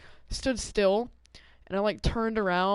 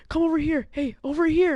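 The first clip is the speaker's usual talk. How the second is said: high, loud imitated hollering; retold shout across a parking lot; beckoning call; 9 semitones higher, 5 dB louder than usual. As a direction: mimic a stranger waving you over. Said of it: "he's telling me".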